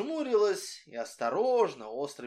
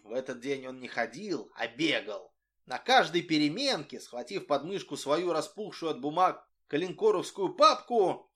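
plain reading voice

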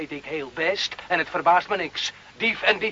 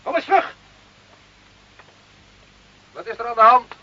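raised voice, high; calling back; 8 semitones higher, 5 dB louder than usual. Acted. Carlo shouts back to the officers from afar, calling. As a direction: call back from down the road.

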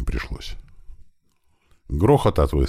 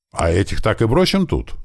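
higher, rising puzzlement; surprised question; 7 semitones higher, 3 dB louder than usual. A narrator's reading. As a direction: ask in mild astonishment. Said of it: "удивлённо спросил Усатый". (удивлённо спросить)

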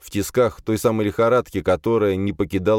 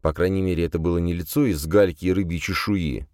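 usual speaking voice

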